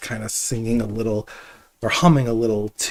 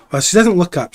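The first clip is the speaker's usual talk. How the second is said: ordinary talk, nothing marked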